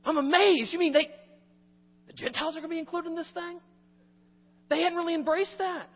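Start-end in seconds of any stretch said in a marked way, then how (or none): 0.0-2.8 higher and louder with wonder; a burst of disbelieving awe; amazed. Acted. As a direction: sound genuinely astonished. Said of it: "I just hear in the".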